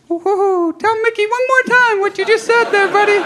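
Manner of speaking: very high falsetto cartoon mouse; chummy squeaky puppet asking for a repeat; Mickey Mouse. Puppeteer dad who can't hear his son, Mickey Mouse voice. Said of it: "as Mickey".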